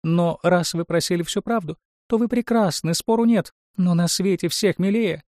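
very high-pitched talking mirror; chattering magic mirror. A narrator's reading. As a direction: chatter truthfully in a high little voice. Magic mirror figure speaking. (высокий голос говорящего зеркала)

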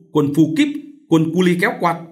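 loud, high-pitched cursing; hurling insults. A narrator's reading. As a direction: spit out the insults loudly. Vợ chửi chồng. (chửi rủa giận dữ)

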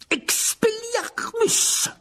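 long, high shouted incantation; cried-out spell. A narrator's reading. Rogue casts his spell at Lockhart, a drawn-out shout. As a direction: draw the spell out in a high cry. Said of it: "s'écria Rogue".

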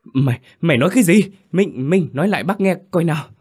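high, loud, fast frightened questions; mother demands to hear the news again, frantic; frantic questioning. A narrator's reading. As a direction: fire the questions at him in panic. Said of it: "hỏi dồn dập, giọng run run".

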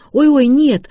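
high, loud alarmed objection; бурный протест. A narrator's reading. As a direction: cry out to stop the deal. Лота бурно протестует против заноса. (громко и высоко, протестуя)

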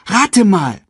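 put-on high, loud eager child; calling out. A narrator's reading. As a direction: cry out a playful challenge. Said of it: "rief Simba".

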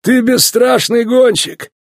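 high, emphatic praise; veteran champion. A narrator's reading. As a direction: veteran talking up a rookie. Veteran champion addressing the rookie.

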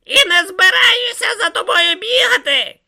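loud, high-pitched screeching; wailing yell. A narrator's reading. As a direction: yell shrilly after a departing companion. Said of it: "лементував Ом".

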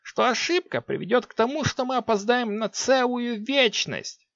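forced courtesy from a sorceress; strained politeness. A narrator's reading. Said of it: "с натянутой вежливостью".

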